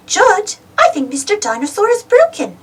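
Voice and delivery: put-on high little girl voice; high girly piglet telling her brother; Peppa's voice. A narrator's reading. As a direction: lift into a bright piglet girl. Peppa voiced, narrator reads.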